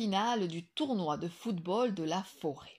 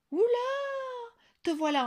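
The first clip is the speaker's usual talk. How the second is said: surprised voice; 6 dB louder, much faster than usual. A narrator's reading. New character discovers hurt wolf.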